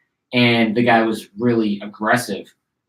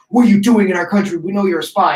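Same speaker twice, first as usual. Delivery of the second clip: imitated angry militia, higher and louder; aggressive accusation; 8 semitones higher, 4 dB louder than usual. Militia guys interrogating a suspected spy, aggressive. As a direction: challenge him sharply, pitched well up. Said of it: "really angry, really aggressive".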